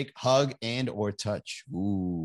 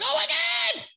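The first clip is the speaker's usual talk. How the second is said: much higher, loud squealing fan shriek; mock starstruck gushing; 5 dB louder, slower than usual. Comedian meeting Tyra Banks, squealing starstruck fangirl shriek. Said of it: "I'm like".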